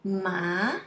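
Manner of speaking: "ma" gliding up from low; second tone. Shown as a demonstration. Teacher gives learners one example, rising tone. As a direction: lift "ma" like a question. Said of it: "Let's see one example here".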